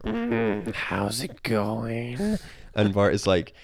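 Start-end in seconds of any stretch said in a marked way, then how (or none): 0.0-2.4 high, very slow Marge rasp. Retold or imitated. Podcast host imitates Marge at the prison, slow and high. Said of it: "You gotta do it in her voice".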